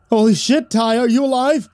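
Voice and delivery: high, alarmed outburst; shocked. Acted. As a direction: blurt it in alarm. Jeremy finds Ty shocked.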